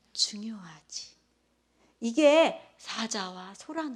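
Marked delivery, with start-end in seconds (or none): 0.1-1.1 soft whisper. Retold or imitated. Feeling-type reply acted out.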